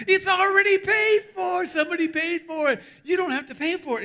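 high, jubilant holler easing slightly as it goes; excited shout. Retold or imitated. Cashier telling puzzled customers it's already paid for, excited shout. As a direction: shout the good news over the counter. Cashier joyfully announcing free food.

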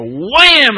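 high, loud drawn-out "wham"; shouted impact noise. Acted. Preacher acting out kicking a listener, sudden loud exclamation. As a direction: blurt out a big kick sound effect. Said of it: "And I, I go".

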